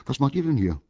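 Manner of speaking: high, loud, surprised question; astonished. A narrator's reading. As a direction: ask in sudden amazement. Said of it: "fragte das Eichhörnchen erstaunt".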